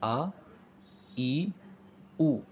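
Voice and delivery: आ, ई, ऊ each said slowly and separately; vowels sounded out. Shown as a demonstration. Teacher drills the class on आ, ई, ऊ. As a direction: sound out the three short vowels one by one. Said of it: "तो ये पहला वाला है".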